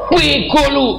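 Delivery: high, drawn insult hurled at someone close; mock angry yell. Preacher imitating an angry person up close, mock angry yell. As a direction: act out a hot-headed outburst. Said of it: "orang marah deket tapi teriak".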